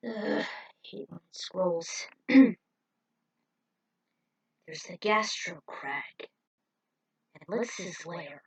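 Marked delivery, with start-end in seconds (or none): 4.9-8.4 hushed. A narrator's reading. Narrator hushes at ominous places.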